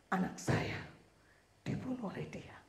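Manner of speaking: hushed, breathless whisper; whispered testimony. Retold or imitated. Mother testifies before the judge in a whisper. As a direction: lean in and whisper it like a secret.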